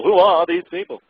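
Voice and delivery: incredulous repeat. Real, not acted. Repeats the question, mocking its wording.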